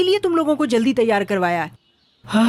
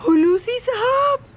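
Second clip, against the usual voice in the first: very high, drawn-out name; gushing greeting; 11 semitones higher, slower than usual. Acted. Hostess welcomes Hulusi sahab at the door, gushing high greeting. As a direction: greet the guest with a slow, high-pitched gush.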